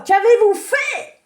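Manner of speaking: high, loud and slow; fairy points at everyone, slow furious demand; accusing fairy. Acted. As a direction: demand it of them all, outraged.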